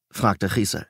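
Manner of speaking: usual speaking voice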